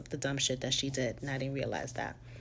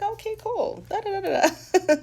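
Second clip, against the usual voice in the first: much higher, clueless sing-song "okay, cool"; airhead; 9 dB louder than usual. Retold or imitated. Brushing off friend's ancestor dream, airhead sing-song. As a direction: play a carefree airhead brushing it off. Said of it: "Airhead at the time".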